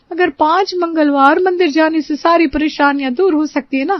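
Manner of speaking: anxious maid in a high put-on voice; high voiced maid.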